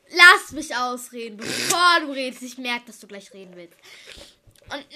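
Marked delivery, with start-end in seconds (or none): 0.1-2.4 loud. Real, not acted. Cutting off her friend.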